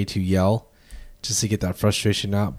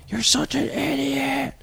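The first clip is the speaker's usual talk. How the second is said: mock insult yell; 4 dB quieter, slower than usual. Shown as a demonstration. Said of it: "And then yelling to".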